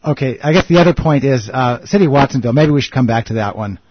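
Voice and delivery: loud, rushing to wrap up; loud and hurried. Real, not acted. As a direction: hurry the point before the break. Rushing before the news break.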